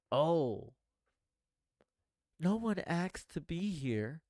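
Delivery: drawn-out, self-pitying mock complaint; mock defeatist whine. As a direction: imitate someone whining that life is unfair. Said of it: "Such a defeatist statement".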